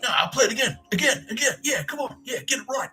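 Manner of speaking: high, driving rehearsal chant; mock pushy bandmate. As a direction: push the band to go again.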